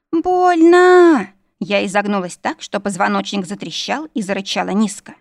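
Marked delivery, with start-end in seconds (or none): 0.1-1.3 long, high, loud wail; cry of pain. A narrator's reading. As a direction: scream it out, stretched, in agony. Heroine howls in feigned agony. (протяжный вопль от боли)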